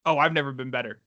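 ordinary talk, nothing marked

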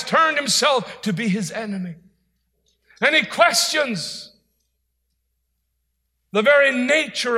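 high, shouted sermon delivery; full-force preaching. Real, not acted. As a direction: thunder the warning at the congregation, high and loud.

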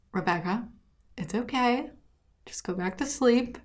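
soft, soothing imitation of a roommate; calming. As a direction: gently hush her back to sleep, quietly. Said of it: "she's so calming".

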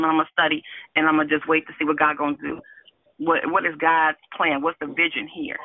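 ordinary talk

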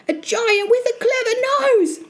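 put-on high-pitched boy's alarmed report; gasping fright. Frightened Jack describing the giant, high alarmed statement. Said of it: "he gasped".

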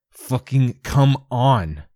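strained, dragged-out curse of effort; straining effort. Acted. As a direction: force the words out while straining every muscle. Straining to move paralysed fingers.

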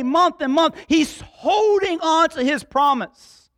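full-force preaching. Real, not acted. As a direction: preach it, pushing the congregation on.

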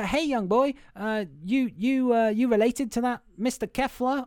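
haltingly friendly put-on voice; acted man questioning boy. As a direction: raise the pitch and call over to a youngster. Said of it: "and he's like".

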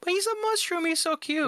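very high-pitched cutesy protest; pleading. Real, not acted. Defending a favorite Pokemon to friends, pleading.

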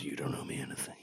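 very soft, whispered film quote; imitated whisper. Retelling Seagal's reply to Sunti, in a whisper. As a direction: whisper it low under your breath. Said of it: "somebody was whispering it".

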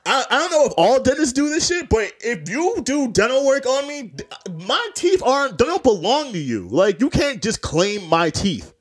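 high-pitched comic outrage; mock-offended rant, high at first and easing down; indignant retort. Acted indignant retort to dentist.

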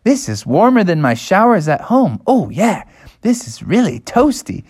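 blissful, singsong character voice; delighted bear cubs. Acted. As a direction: savor the warmth with big pitch swoops. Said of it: "they were like".

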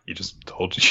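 mock whisper. Doubt sniping at Mash, whispered deadpan. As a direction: whisper the snark under your breath.

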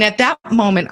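ordinary delivery

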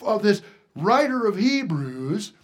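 loud, high preaching; rising pulpit cry. Real, not acted. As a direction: preach it out with fire. Preaching at full force.

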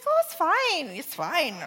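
high, airy mock reassurance; babysitter brushing it off. Babysitter hiding bad news from parent, high breezy brush-off. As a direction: toss it off too lightly. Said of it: "She's like".

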